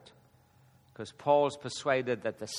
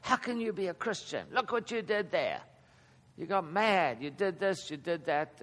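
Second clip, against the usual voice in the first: higher-pitched mock accuser; 4 semitones higher than usual. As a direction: imitate someone pointing out a believer's faults. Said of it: "Non-Christians want to accuse us".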